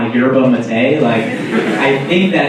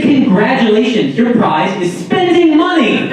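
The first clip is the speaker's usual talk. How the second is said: higher, exasperated mocking shout; mock congratulations; 10 semitones higher, 3 dB louder than usual. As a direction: crow it in sarcastic triumph.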